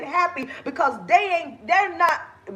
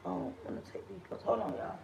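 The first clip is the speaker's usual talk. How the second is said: quick hushed whisper to herself; whispered aside; 5 semitones lower, 15 dB quieter, faster than usual. Real, not acted. Host muttering to herself about clips, whispered quickly. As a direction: drop to a whisper, fast, off-mic. Aside while live-streaming.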